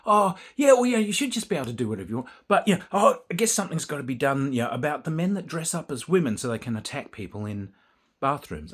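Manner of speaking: put-on high, loud, fast West Australian accent. Con organiser backpedalling about bathrooms, West Australian accent. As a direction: gush it out like a chatty West Australian. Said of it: "she's got the West Australian accent".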